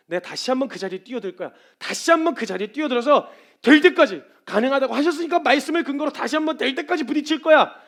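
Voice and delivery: building to a high, loud cry; rally yourself with rising force; fierce resolve. Acted. Believer vowing to dive back in, fiercely determined. Acted resolve to try again.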